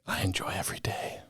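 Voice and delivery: soft unvoiced whisper. Real, not acted. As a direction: whisper it close to the mic. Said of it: "I don't think it's coming through on the mic".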